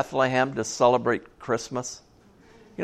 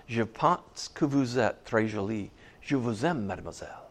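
schoolboy French; 5 semitones lower, 9 dB quieter than usual. Retold or imitated. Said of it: "I want you to go up and say this".

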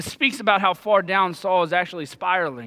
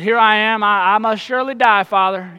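a dry, sarcastic offer, said louder and a little higher; 3 semitones higher, 7 dB louder than usual. Retold or imitated. Jonathan answers his father with raised, biting sarcasm. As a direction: let the sarcasm sit on every word. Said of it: "You can kinda sense the sarcasm in his voice".

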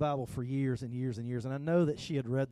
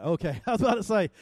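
laughing; 6 semitones higher, 7 dB louder, faster than usual. Real, not acted.

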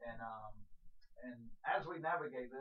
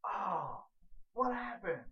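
higher, louder feigned shock; mock startled gasp; 6 semitones higher, 5 dB louder, much slower than usual. Shown as a demonstration. Preacher demonstrating a panicked one-handed clap, mock startled. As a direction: act caught completely off guard. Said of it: "It's like this".

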